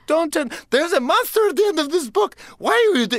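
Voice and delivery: very high, frantic Grover alarm. Retold or imitated. Grover hammering the wall, frantic and very high. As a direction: shriek the warning like Grover.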